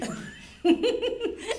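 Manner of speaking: a loud, high peal of laughter; high burst of laughter. Real, not acted. Laughing at Spike Lee mixup.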